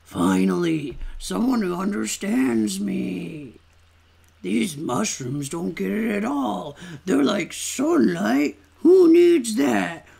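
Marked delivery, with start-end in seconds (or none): 1.2-10.1 character voice. Acted. Voicing a character in the cave.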